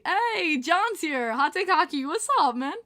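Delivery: big hollered welcome; hearty shouted greeting. Retold or imitated. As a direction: hail a pal across the room.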